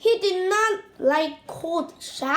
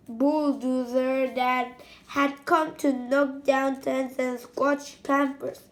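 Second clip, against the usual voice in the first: ordinary delivery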